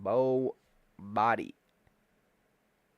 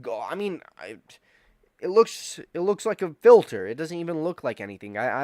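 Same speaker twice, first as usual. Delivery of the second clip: ordinary delivery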